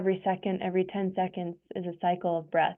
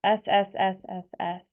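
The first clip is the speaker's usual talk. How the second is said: long hissing S on the exhale; drawn-out s like a snake. Shown as a demonstration. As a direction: hiss out slowly like a snake. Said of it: "making the sound like a snake".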